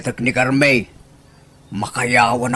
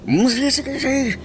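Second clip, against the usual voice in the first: high eager agreeing; 13 semitones higher than usual. Acted.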